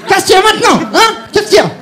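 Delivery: mock thug shout. The guy on the train shouting at him, mock threat. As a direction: yell it at him like a street thug.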